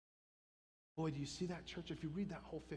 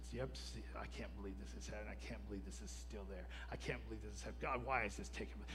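low, quick grumble under the breath; grumbling; 6 semitones lower, faster than usual. Retold or imitated. Preacher acting out his own complaining to church, grumbling. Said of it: "I just grumble and grumble".

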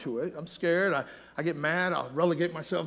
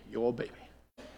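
ordinary talk, nothing marked

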